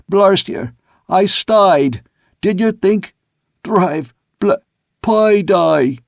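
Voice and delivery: high, halting cockney shouts; high, fragmented holler, last word stretched; broken cry from aloft. A narrator's reading. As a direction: shout disjointed scraps down from the mast. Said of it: "unintelligible and unexpected. It ran like this".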